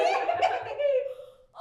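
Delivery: high, loud giddy laughter; joyful laugh. Acted. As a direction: laugh out loud, carried away with joy. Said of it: "off my balance with joy".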